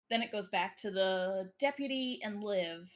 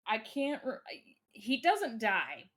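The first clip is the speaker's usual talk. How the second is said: ordinary delivery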